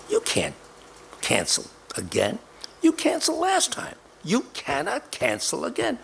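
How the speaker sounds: a wife scolding her husband, pitch jumping up on the quote; retold angry wife. Retelling how his wife scolded him for cancelling, in a mock angry voice. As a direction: insist he can't cancel again, rising in protest. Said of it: "And she got angry".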